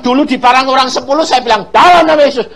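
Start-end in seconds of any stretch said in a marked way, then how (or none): 1.7-2.5 loud, high-pitched rebuke; acted-out cry of authority; retold command. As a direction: command it forcefully, as if driving someone off. Recalls commanding robbers with authority.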